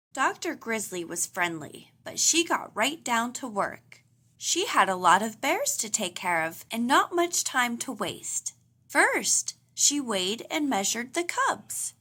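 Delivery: plain reading voice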